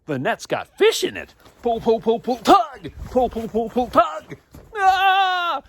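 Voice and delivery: rising excitement from a hushed what was that to high, loud pull pull cries; mounting thrill, loud and high; excited hauling. Acted. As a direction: chant the hauling as the net grows heavy. Acting out the hauling of fish.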